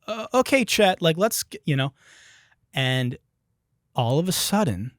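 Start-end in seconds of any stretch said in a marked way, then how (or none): 0.1-1.6 higher, hesitant calming voice; nervous union president. Retold or imitated. Union president pulling Chet away, higher and hesitant. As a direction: try to defuse it nervously. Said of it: "so he's kinda like".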